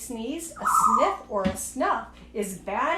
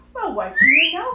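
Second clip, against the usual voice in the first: louder, amazed exclamation; surprised outburst; astonished cry; 3 semitones higher, 8 dB louder, faster than usual. A narrator's reading. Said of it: "cried the elephant".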